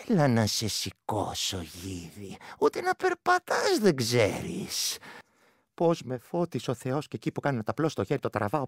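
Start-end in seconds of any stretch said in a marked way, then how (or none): 0.1-5.0 creature mocking. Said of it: "και μου λέει".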